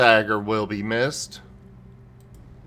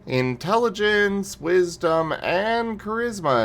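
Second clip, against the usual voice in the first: excited; 5 semitones higher, 5 dB louder, slower than usual. Real, not acted. Excited about a stat boost.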